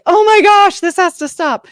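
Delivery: high, loud panicked gasp of alarm; sudden alarm as the yelling starts. Retold or imitated. Mother panicking as her daughter yells at him, high and loud. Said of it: "I was like".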